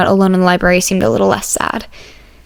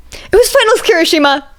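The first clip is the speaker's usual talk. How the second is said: indignant protest; 9 semitones higher than usual. Acted. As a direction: defend yourself, it was exam week. Izuku protests loudly.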